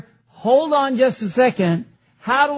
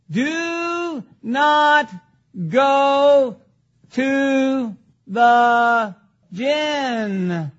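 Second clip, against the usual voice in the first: very slow, each word held long; word-by-word emphasis; much slower than usual. Shown as a demonstration. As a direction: separate every word so it sinks in. Spelling out the doctrine word by word.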